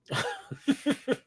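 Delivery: high amused laugh; a short laugh at the rumor. Real, not acted. Laughs after Texas rumor.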